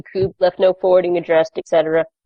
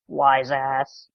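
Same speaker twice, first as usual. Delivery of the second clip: low grumble; 5 semitones lower, 5 dB quieter than usual. Retold or imitated. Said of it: "Winston's like".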